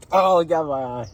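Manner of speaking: loud startled yelp; startled outcry. Real, not acted. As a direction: cry out as it hits. Drink splashed in eye.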